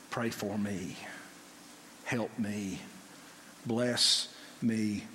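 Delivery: very soft, low frightened begging; desperate plea. Acted. Pharaoh afraid, begging softly for blessing. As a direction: plead in hushed fear. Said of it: "here is Pharaoh at that place".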